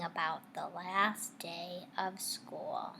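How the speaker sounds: slow, hushed and wistful; whispered. A narrator's reading. Teacher reading to class, hushing the sad words. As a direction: let the words fade to a whisper. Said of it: "felt a little blue".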